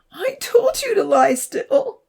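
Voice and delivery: loud, high, drawn-out wail; upset high cry, slow and loud; sobbing. A narrator's reading. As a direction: wail it as if through sobs.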